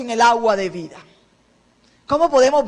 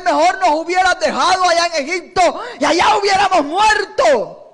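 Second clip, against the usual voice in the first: complaining people; 5 semitones higher, 3 dB louder than usual. Retold or imitated. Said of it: "le dijeron a Moisés".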